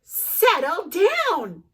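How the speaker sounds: high, slow, drawn-out order; calm the wild boy down; firm mom. Acted. Mom calming in story.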